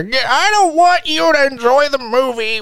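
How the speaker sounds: put-on high, slow cranky old man voice; grumpy old man. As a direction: whine it like a grumpy old-timer.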